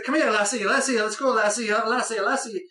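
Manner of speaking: hammed-up amateur Scottish accent; bad Scottish accent. Film reviewer imitating Scotty calling the female character, bad Scottish accent. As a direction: imitate Scotty with a badly faked Scottish lilt. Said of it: "There's my bad Scottish accent".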